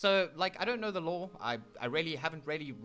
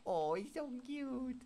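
high, slow coo; soft-edged, high-pitched fondness; gushing; 5 semitones higher, 4 dB quieter, slower than usual. Real, not acted. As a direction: coo adoringly, drawing it out. Gushing over the cat.

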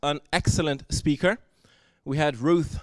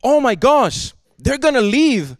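higher, louder, a flustered mock panic; 5 semitones higher, 5 dB louder than usual. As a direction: play a panicking God for the congregation, half in jest. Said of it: "God is not freaking out and saying".

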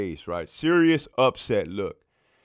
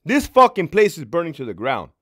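loud, high exasperated outburst; angry; 7 semitones higher, 8 dB louder than usual. Retold or imitated. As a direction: let the frustration burst out. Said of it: "I said".

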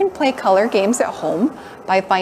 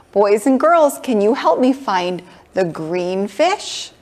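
usual speaking voice

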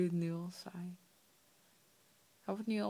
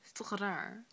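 ordinary talk

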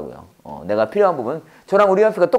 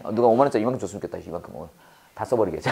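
joking aside; 11 semitones lower, 8 dB quieter, much faster than usual. Real, not acted. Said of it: "헛소리 할 수 있어요?".

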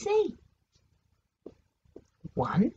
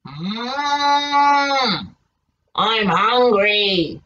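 wolf voice; 10 semitones lower, much slower than usual. Acted. Wolf speaks in story.

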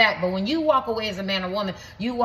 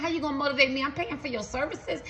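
higher, scolding a useless coach; mock the coach who can't motivate you, pitched up; fed-up client; 6 semitones higher than usual. Acting a fed-up paying client.

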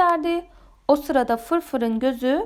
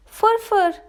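high, raised-voice cry of the name; shouted call for help; 6 semitones higher, 4 dB louder than usual. A narrator's reading. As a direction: call out urgently to a friend. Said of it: "diye bağırdı diğer balıklar". (telaşla bağırarak seslenme)

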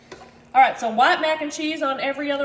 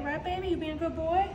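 pet talk; 7 dB quieter than usual. Real, not acted. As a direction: coo softly to your pet. Cooing to her pet dog.

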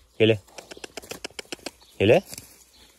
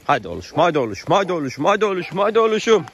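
building urging; 7 semitones higher, 4 dB louder, faster than usual. Real, not acted. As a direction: cheer them on, faster and faster. Urging horses along.

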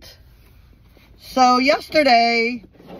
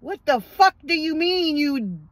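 angry retort; 5 semitones higher, slower than usual. Retold or imitated. Repeats the retort, higher.

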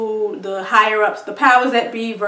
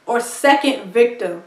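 usual speaking voice

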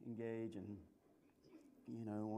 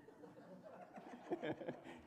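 airy high giggle under the breath; breathy giggle; 10 dB quieter than usual. Real, not acted. Preacher giggling at his own joke to the crowd. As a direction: let a soft laugh slip out. Speaker laughs at own story.

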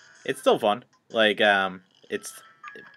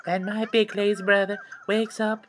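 put-on high little girl voice; 11 semitones higher than usual. Voicing little sister.

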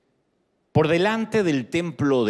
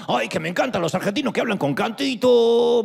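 higher, singsong imitation of a Mexican friend; mock Mexican accent; 8 semitones higher than usual. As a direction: tease the Mexican lilt, lightly. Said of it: "me decía algún mexicano". (imitando con sorna el acento mexicano)

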